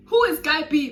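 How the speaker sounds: loud, high disbelieving shout; outraged question. Retold or imitated. Retelling her shock at hearing the boyfriend named, loud. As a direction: demand it in outrage.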